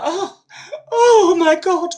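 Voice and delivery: high, breathy mock gasp of a damsel; mock swooning wife. Sylvia pretends fear at the refinery, high fluttery gasp. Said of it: "she was just like".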